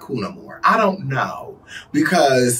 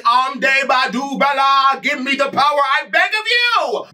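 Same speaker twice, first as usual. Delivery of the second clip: mock spell-casting; 7 semitones higher than usual. Imitating a voodoo ritual for laughs, high mock incantation. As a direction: chant it high like a hammed-up spell.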